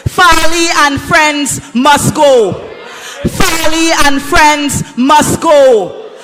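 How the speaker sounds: slow, shouted protest chant; loud soapbox ranting. Real, not acted. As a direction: lead the crowd in a chant. Host leads crowd chant.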